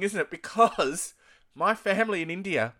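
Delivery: normal talking voice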